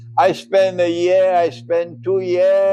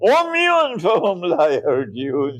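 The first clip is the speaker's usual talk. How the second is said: harsh recitation; 7 semitones higher than usual. Acted. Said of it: "This is harsh language".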